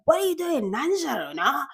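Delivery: high, sing-out mum voice; scolding mother. Acted. Mother asking her child what they're up to, high-pitched scolding. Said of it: "like, a mother talking to her child".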